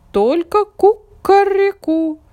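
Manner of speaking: rooster voice. A narrator's reading. Rooster answers.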